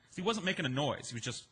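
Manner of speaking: usual speaking voice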